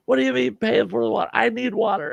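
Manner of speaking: high, fast pleading objection; frantic protest. Retold or imitated. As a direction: argue it like life depends on it. Arguing back with uncle.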